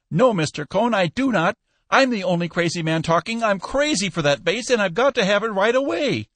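high, urgent pleading; frantic insistence. A narrator's reading. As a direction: insist like a man crazy to buy. Frantic buyer insists he must have vase.

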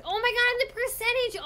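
very high and loud excitement; thrilled exclamation. Real, not acted. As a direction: burst out in delight at progress. Thrilled at memory progress.

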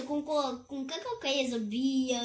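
normal talking voice

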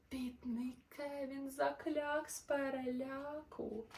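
lively, sing-song storybook narration; animated bedtime-story voice; reading to children. A narrator's reading. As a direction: read the rhymes brightly to little listeners. Reads a rhyming children's story.

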